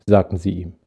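calm storyteller voice reading a Christmas tale; story narration. A narrator's reading. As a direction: tell it gently to listeners leaning back. Reads story aloud. (ruhige Erzählstimme einer Weihnachtsgeschichte)

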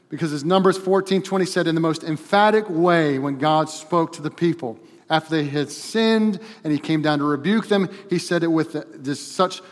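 loud, driving pulpit delivery; forceful preaching. Real, not acted. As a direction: build with urgency toward the quote.

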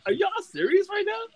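squeaky high mock disbelief; incredulous. Podcaster retelling his reaction to everybody cheering, incredulous. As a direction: question them in a very high, disbelieving voice.

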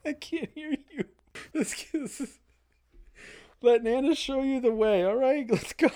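way up high and playful; high-pitched voice. Pretend. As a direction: squeal it out, goofy and high. Silly voice for outro line.

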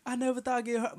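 high-pitched imitation of a crying boy; sigh, then a put-on high whimper; mock crying. Imitating little brother crying over a hurt leg, mock crying. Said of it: "This dude cried".

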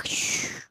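soft breathy snore hiss; shushing snore sound. Acted. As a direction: hiss like a sleeping tadpole's snore. Snoring sound in story.

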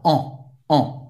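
"On" as a strongly nasal French vowel. Shown as a demonstration. Teacher showing the sound to learners, "On" very nasal. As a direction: send "On" through the nose, no final N. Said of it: "tem um som bem nasal".

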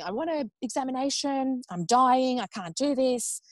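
retold high-pitched, desperate demand. Labouring mother demanding an examination, desperate. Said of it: "that's when I was demanding".

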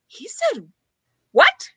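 high, baffled protest; playing innocent in disbelief; mock confused. Defending herself, retold.

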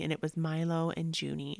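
ordinary talk, nothing marked

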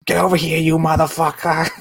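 put-on high shouted movie line in a crazy accent; screaming movie quote. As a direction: yell it like the film's villain. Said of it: "all of the accents that are involved".

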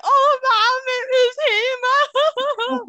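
high, loud, drawn-out mock wail to her mum. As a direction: whine it like a dramatic teen, stretched and shrill-high. Wailing to mum about the guy.

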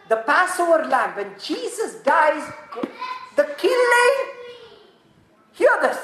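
high, loud and slow preaching; impassioned sermon climax; fervent slow preaching. Acted. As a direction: lean on every word for the congregation. Preaching at full force.

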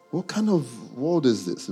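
usual speaking voice

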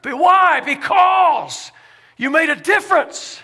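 loud, high preacher's cry; preaching at full force. Acted. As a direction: lift it up like a preacher. Preacher rising to a shout.

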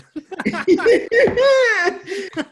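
bursting into loud, high laughter; can't hold back a big laugh. Real, not acted. Laughing hard at joke.

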